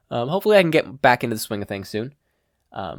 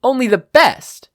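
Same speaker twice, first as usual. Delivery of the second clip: much higher, louder and slow; cocky boast; 12 dB louder than usual. Acted. Katsuki boasting to Shishido, loud cocky crow. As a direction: crow it out, full of yourself.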